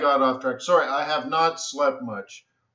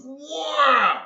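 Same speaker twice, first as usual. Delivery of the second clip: high, drawn-out "wah"; high mock screech; swooping eagle noise; 12 semitones higher, 3 dB louder than usual. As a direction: imitate a big bird diving in. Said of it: "and he goes".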